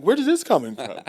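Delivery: high, loud shocked question; shocked high exclaim. Retold or imitated. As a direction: sound truly startled. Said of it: "She's like".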